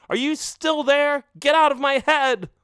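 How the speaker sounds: high, loud, panicked shout; frantic yell. A narrator's reading. Skeletor yells at the villain in his head, panicked. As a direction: yell at the voice to leave.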